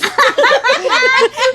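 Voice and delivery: high giggling burst; high laugh. Real, not acted. Laughing at blown kisses.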